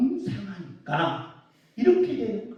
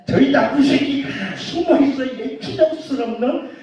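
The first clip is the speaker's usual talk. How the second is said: ordinary talk, nothing marked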